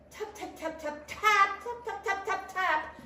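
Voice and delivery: high, rapid "tap tap" imitation; tapping noise. A narrator's reading. Noise disturbing Tortoise as he closes his eyes, tapping. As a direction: rattle off the taps high, accenting some.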